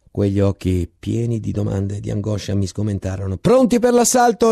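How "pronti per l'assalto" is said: much higher, louder battle cry; captain's shout. Acted. Captain again orders the assault, shouting. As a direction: yell it with rising urgency. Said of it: "rispose ancora il capitano".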